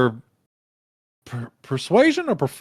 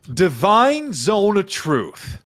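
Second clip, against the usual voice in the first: slow, grand spell announcement, pitched up; spell casting; 8 semitones higher, 3 dB louder, much slower than usual. Acted. Game master casts a truth spell, slowly proclaimed. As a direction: draw the words out as you cast.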